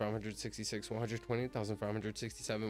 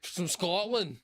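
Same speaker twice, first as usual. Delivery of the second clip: put-on high-pitched, amateur Scottish accent; wobbly imitation of a Scot; attempted Scottish accent; 7 semitones higher than usual. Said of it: "I think I had it kinda on".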